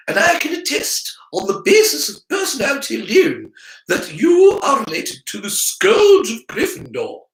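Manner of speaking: high, loud exasperated schoolmistress; stern witch. Acted. Professor scolding the boy about his father, loud and exasperated. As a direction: scold him like a strict old professor.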